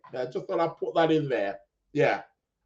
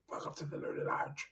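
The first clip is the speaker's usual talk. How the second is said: breathy hushed welcome, fast; whisper; 19 dB quieter, faster than usual. Real, not acted. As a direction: whisper the welcome without meaning to. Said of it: "why am I whispering?".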